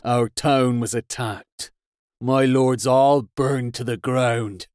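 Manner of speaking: slow, strained seething voice. Acted. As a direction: drag out each word with effort. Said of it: "seethed Hafgan, his voice strained, each word arduous".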